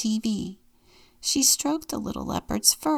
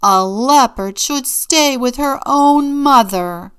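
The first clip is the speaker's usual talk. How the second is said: slow, high, very loud bellow; roaring; 6 semitones higher, 13 dB louder than usual. A narrator's reading. Mama claims her cub at the zoo, roaring. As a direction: bellow every word with force. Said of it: "Mama roared".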